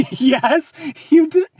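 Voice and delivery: high, loud burst through laughter; laughing disbelief. Real, not acted. Amused by mustache comment.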